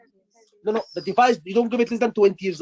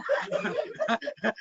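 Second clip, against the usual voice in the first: laughing; 11 semitones higher, 9 dB quieter than usual. Real, not acted. Laughs at colleague's joke.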